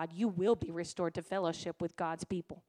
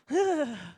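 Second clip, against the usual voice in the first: heavy sigh; 5 semitones higher, 6 dB louder than usual. Retold or imitated. Teen giving in to mom.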